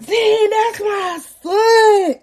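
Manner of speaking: high, drawn-out proud wail; hammed-up new dad crying out, stretching the words; mock elated cry. Baby daddy first seeing his newborn, mock elated cry.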